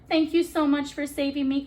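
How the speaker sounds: high, loud grateful rush; breathless with relief; gulping thanks. Acted. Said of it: "gulped Betsy".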